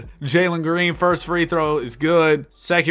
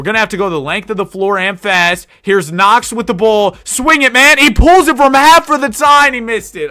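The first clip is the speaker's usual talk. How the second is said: much higher, louder shouted play-by-play; frantic call; 6 semitones higher, 9 dB louder than usual. Acted. Streamer calls the half-court heave for the tie, frantic shout. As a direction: yell it as the last shot flies.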